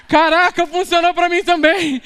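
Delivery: high, loud, slowed amazement; retold joyful amazement; excited exclaim. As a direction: burst out amazed that it worked. Retelling joyful surprise at healing.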